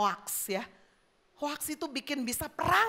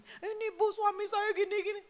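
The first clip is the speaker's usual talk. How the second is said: high-pitched mock complaining wife; tearful wife; 8 semitones higher than usual. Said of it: "Lu nangis, udah mau cerai". (istri mengadu, suara tinggi dibuat-buat)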